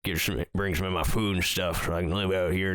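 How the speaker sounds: put-on lightly done Southern hillbilly accent, unhurried. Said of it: "deep South redneck guy".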